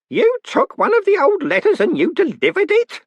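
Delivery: put-on scandalised old clerk; old postman aghast. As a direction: gasp it out like a fussy old postman. Groat aghast at old letter.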